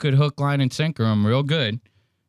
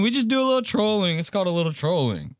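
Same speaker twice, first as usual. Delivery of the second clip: higher, faster imitation of his catchphrase; Donald Trump impression; 5 semitones higher, faster than usual. Host mimicking Trump's soundbite for the stream, playful. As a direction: toss it off in a mock Trump voice. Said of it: "You know how Donald Trump says it".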